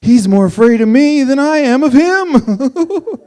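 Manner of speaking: high, slow, put-on voice quoting the silly advice; parroting advice in a sing-song voice; mock know-it-all. Mocking snake advice.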